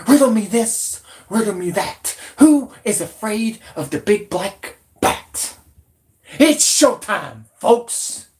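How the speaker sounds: high-pitched, hammed-up riddling announcer; taunting showman's flourish, pitched high; theatrical showman. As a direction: draw out the big lines like a ringmaster. Showman introducing his news segment theatrically.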